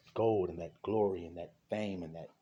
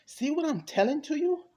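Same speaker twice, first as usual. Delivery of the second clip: high, loud appeal; urgent insistence; 7 dB louder than usual. Real, not acted. Preacher challenging his listeners to understand, loud and insistent. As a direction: press the question loudly, voice way up.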